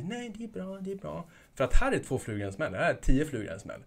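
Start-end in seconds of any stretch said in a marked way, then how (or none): 0.0-1.3 high, soft polite brush-off, much higher; a quick, airy no-thanks; dismissive brush-off. Retold or imitated. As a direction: mimic a customer waving you away, soft and high. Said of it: "Då kommer det inte heller bli".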